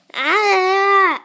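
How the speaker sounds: sharp high cry of dismay; frustrated cry. Real, not acted. Gamer crying out as his cell gets eaten. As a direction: yelp as everything is lost.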